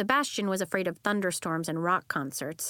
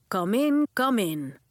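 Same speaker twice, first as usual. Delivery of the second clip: louder booming welcome; 6 dB louder, slower than usual. Acted. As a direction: boom it across the gym. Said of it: "a voice boomed".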